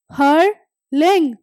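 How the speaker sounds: हर्लिंग drawn out slowly, T silent; slow clear repeat. Shown as a demonstration. Teacher repeats हर्लिंग slowly for students. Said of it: "दोबारा सुन लो".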